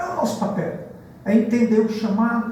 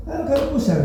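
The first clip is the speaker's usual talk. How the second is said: mock eager reply; 8 semitones higher, faster than usual. Brother's reply in retold exchange.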